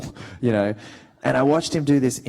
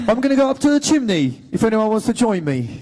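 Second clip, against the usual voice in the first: loud, high mock Cockney; Cole; 10 semitones higher, 6 dB louder than usual. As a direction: announce it like a Cockney chimney sweep. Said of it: "more of a Cockney".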